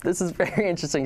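amused and baffled; laughing. Retold or imitated. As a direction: chuckle through it. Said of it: "And I was like".

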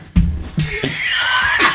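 breathy, wheezing laugh; real laugh. Real, not acted. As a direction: crack up at your own joke. Cracks up after own joke.

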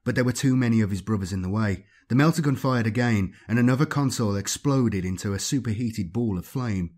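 plain reading voice

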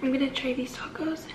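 soft unvoiced whisper. Real, not acted. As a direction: whisper it to the camera. Whispered repeat.

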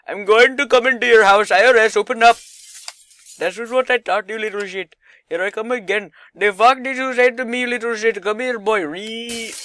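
high, loud put-on accented voice; mock-threatening character. Gamer storming a house as I R S, mock-threatening shout. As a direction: shout threats in a hammed-up comic voice.

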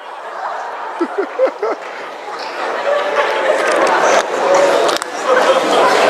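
long breathy high laughter. Real, not acted. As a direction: laugh hard and long, airy. Laughing at own punchline.

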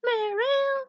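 high squeaky call that cracks. Real, not acted. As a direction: squeal the name until the voice breaks. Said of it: "Voice crack".

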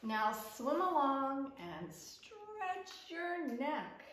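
lively picture-book reading, calls of yes and hooray up high; reading to children. Acted. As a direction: read it to little ones at story time. Reads picture book aloud.

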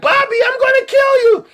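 loud, very high mock screech; exasperated mother yelling. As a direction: yell like a mom at her wits' end. Harried mother yelling at her kids.

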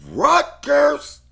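very high, very loud, disbelieving; shocked outburst. Real, not acted. Bettor shocked to see Rutgers listed, high loud yelp.

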